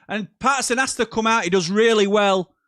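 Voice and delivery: high, loud live match call; rising excitement as the keeper rushes out; excited commentary. Real, not acted. Commentating live on a back pass, high and loud.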